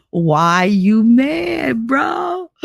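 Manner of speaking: very slow, rising tease; mock taunt. Teacher teasing her bitter principal all night, taunting. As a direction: drag out each word, climbing high on bro.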